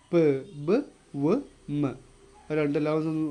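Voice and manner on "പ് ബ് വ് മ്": പ്, ബ്, വ്, മ് each said alone with both lips; bilabial sounds. Shown as a demonstration. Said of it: "ഒന്ന് just pronounce ചെയ്ത് നോക്കുകയാണെങ്കിൽ". (പ്, ബ്, വ്, മ് രണ്ട് ചുണ്ടും ചേർത്ത് ഓരോന്നായി)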